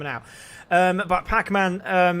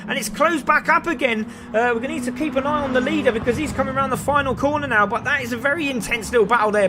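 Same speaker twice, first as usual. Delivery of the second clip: raised, energetic play-by-play call; live race commentary; 7 semitones higher, 5 dB louder than usual. Acted. Commentator calling the finish to viewers, excited play-by-play. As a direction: call the race as the cars cross the line.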